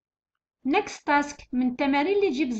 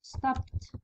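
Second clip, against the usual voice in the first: stopped whispered with a final t; whispered model word; 7 dB quieter than usual. Shown as a demonstration. Teacher whispering stopped to students, soft final t. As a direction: breathe stopped out softly, ending on t. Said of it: "راكو تشوفوا ت".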